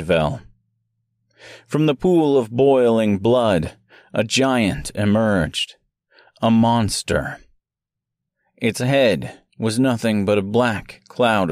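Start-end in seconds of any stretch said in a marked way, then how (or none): none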